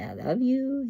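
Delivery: high-pitched parrot phrase; imitating parrot talk. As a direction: say it high, like a pet bird's catchphrase. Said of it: "He can say".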